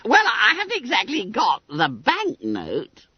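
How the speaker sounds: high, squeaky schoolboy hedging; admitting Billy Bunter. A narrator's reading. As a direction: concede it in a high boy's pipe. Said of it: "admitted Bunter".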